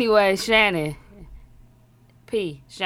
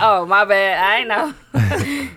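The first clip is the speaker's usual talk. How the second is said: laughing; 7 dB louder, much slower than usual. Retold or imitated. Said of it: "I'm like".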